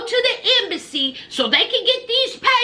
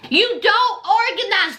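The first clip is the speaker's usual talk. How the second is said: ordinary delivery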